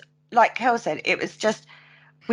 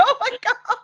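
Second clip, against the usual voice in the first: mock scream; 14 semitones higher than usual. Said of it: "all I can hear in the background is screaming".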